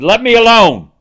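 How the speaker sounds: "Let me alone" कहो, ऊँचा और ज़ोर से, "alone" पर ज़ोर; a wrathful God ordering; God's angry command. Retold or imitated. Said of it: "He said".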